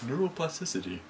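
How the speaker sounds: soft, careful expert pronunciation; technical voice. Shown as a demonstration. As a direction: say it like a lightly pompous expert. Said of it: "that's my technical voice".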